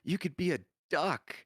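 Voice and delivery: high, eager child impression; imitated excited kid. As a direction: imitate a kid bursting with an idea. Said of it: "He's like".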